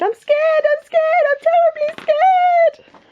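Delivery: very high repeated squeal, stretching at the end; terrified cook. Acted. Cook declaring his fear to the king, squealing in terror. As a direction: build a hammed-up wail of fear.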